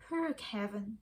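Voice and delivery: ordinary delivery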